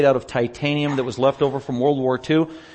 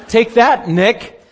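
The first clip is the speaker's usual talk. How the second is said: higher and louder, gleeful challenge; triumphant; 8 semitones higher, 7 dB louder, much slower than usual. Retold or imitated. Accepting his translator's challenge, gleeful and loud. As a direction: throw down the gauntlet with a laugh.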